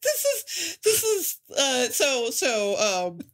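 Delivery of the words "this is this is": laughing through the words; giggling with delight. Real, not acted. Delighted recalling favourite scene.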